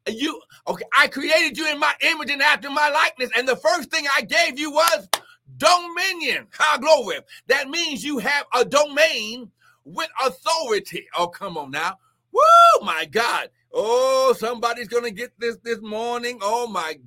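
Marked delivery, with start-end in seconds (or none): 0.1-11.1 full-force preaching. Acted. Preacher shouting God's word about dominion, full force. 12.3-12.9 piercing high whoop, much louder; ecstatic woo. Real, not acted. Preacher crying out in praise to viewers, piercing whoop.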